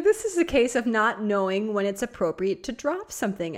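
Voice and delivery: ordinary talk, nothing marked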